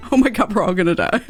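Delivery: usual speaking voice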